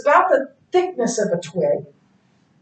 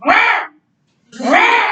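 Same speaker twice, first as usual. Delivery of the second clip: bird screech imitation; 7 semitones higher than usual. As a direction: squawk it like an ugly bird. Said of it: "this bird in the tree was like".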